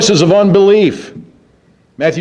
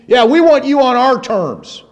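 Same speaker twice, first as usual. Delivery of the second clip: mocker's taunt; 5 semitones higher than usual. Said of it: "they want Jesus on their terms".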